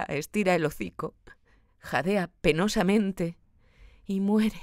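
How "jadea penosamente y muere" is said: ordinary delivery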